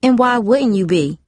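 a touch of scornful challenge; snapped. A narrator's reading. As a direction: needle them a little. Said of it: "Brasana snapped".

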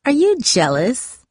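slow, high-pitched taunting question; drawn-out, needling mockery; mocking. A narrator's reading. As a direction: tease with exaggerated mock surprise. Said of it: "spoke in a mocking voice".

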